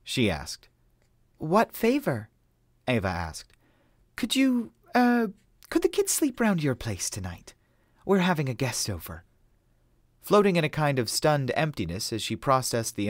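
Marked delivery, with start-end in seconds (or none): 1.4-2.3 higher, lightly voiced woman asking; a curious friend asking; woman's voice. A narrator's reading. Friend asking Ava a favor, light higher voice. 4.1-9.2 hesitant, higher, lightly voiced woman; higher woman's voice. A narrator's reading. Haley asking Ava to babysit, hesitant higher voice.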